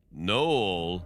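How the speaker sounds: intruder's restrained shout; deep-voiced shout. A narrator's reading. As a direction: call the name out long, like a menacing intruder. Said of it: "in a deep voice, shouted".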